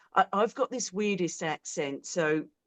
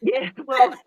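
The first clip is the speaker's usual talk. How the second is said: laughing; 7 semitones higher, 8 dB louder than usual. Real, not acted. Laughing after a joke about accents.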